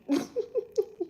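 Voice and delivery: high-pitched giggle; high laugh. Real, not acted. Laughs at her claim.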